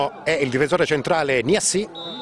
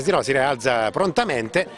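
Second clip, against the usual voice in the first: normal talking voice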